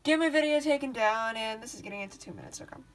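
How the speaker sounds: usual speaking voice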